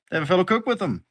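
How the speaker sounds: an eager cook impressed by a colleague; NPC cook. Acted. NPC cook praising the party's cook, high and eager.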